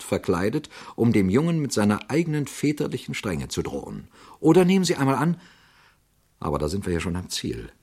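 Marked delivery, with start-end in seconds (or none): 4.4-5.4 excited. Shown as a demonstration. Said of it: "same phrase, heated third time".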